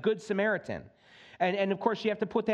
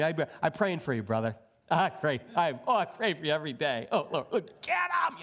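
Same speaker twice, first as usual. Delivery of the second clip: mock hypocrite prayer; 3 semitones lower than usual. Mock hypocrite praying for brother.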